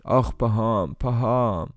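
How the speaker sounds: sore-footed groan with falling tune; half-asleep moan. A narrator's reading. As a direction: moan it as if only half awake.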